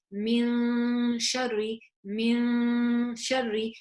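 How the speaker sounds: "min" held very long before sharri; slow, drawn-out "min sharri" with nasal hold; nun hiding contrast demo. Shown as a demonstration. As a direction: sustain "min" on a steady note, then say sharri. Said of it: "there's a difference between me saying".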